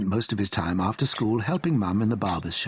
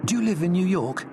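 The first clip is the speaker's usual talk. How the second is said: Billy's voice; 8 semitones higher than usual. A narrator's reading. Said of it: "asked Billy".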